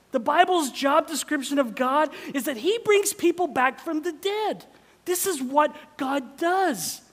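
loud, high preaching at full force; rising sermon proclamation. Real, not acted. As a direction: proclaim it to the whole room.

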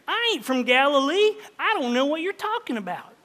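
put-on Peter denying in a mild Southern accent; a hint of a rural Southern accent; Southern-style accent. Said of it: "That's Peter here".

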